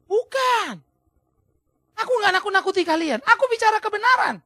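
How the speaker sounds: high, forceful acted denial; impassioned protest. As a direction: insist hotly as the accused apostle. Acts Paulus protesting to his accusers.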